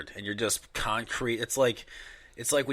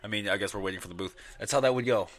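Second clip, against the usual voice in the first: ordinary delivery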